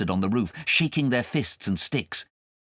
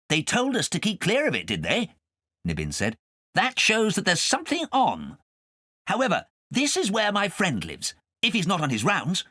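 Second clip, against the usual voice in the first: high, light cat voice; cat's voice; 9 semitones higher than usual. A narrator's reading. Nibbins telling Kay where to land, high and light. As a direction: speak as a friendly cat.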